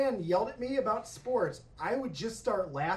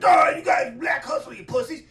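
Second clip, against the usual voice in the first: loud, higher mock coach yell; imitated screaming coach; 5 semitones higher, 9 dB louder than usual. Coach berating the football team at halftime, mock screaming. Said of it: "he's, like, screaming at us".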